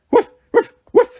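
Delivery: high, loud imitated woofs; dog bark. A narrator's reading. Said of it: "said Trotsky".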